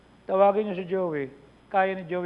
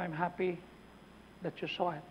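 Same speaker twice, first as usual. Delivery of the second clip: soft retold reply; 2 semitones lower, 10 dB quieter than usual. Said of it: "Sabi ko".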